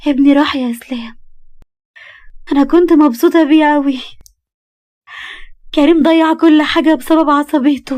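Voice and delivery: high, loud tearful wail, slowing down; crying. Acted. Wife telling cousin Islam she lost the baby, crying. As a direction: sob out the loss of her baby. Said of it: "وقالت ببكاء".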